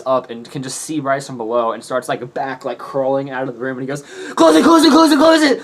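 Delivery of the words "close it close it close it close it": very high, loud laughing shriek; panicked shriek. Retold or imitated. Jaden crawling out, panicked.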